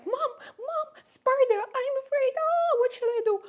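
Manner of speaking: squealing high, half-laughing panic; scared little daughter. Retold or imitated. Mom imitating her daughter panicking at a tiny spider, high squealing fright. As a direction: squeal like a kid who just spotted a spider. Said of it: "she's like".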